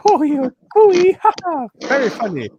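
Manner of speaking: very high, hammed-up American woman laughing; exaggerated imitation of an amused American woman. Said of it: "she had an American accent".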